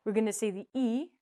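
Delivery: ordinary talk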